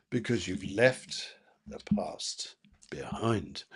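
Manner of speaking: soft unvoiced whisper to the viewer. Real, not acted. Reader whispering to the client about the past. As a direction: breathe it out like a secret. Whispered aside while choosing cards.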